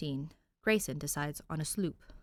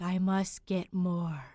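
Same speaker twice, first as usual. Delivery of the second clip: very slow, hungry, drawn-out chant; like a zombie craving the next chapter; mock-possessed slow drone; obsessed reader; 2 semitones higher, much slower than usual. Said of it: "I was just like".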